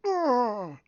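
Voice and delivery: high, strained argh of being thrown back; a short pained cry as he flies; pained grunt. Retold or imitated. Character thrown backwards.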